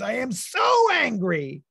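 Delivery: high, loud, slow mock shout; angry kid shout. Boy on the playground yelling at Doctor Matt, mock shout. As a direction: shout it like a furious six-year-old. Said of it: "He goes".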